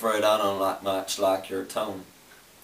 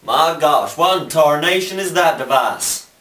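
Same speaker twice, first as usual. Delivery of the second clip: higher, louder Southern exclamation at the strange device; hammed-up Southern shock; mock astonished cowboy; 7 semitones higher, 7 dB louder than usual. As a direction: gasp at it like an old-timer seeing a phone. Startled cowboy.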